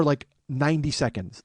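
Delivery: ordinary delivery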